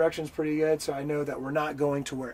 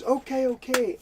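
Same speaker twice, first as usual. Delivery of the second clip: high mock concession; 10 semitones higher, 5 dB louder than usual. Scammer gives in.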